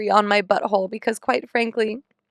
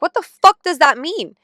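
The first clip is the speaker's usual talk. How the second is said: higher, louder baffled cry; exasperated outburst; 7 semitones higher, 5 dB louder than usual. Retold or imitated. Patient baffled by vague advice, loud exasperated cry. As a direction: fling out the question in disbelief.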